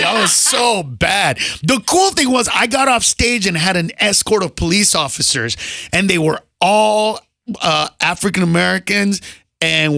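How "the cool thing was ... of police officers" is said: usual speaking voice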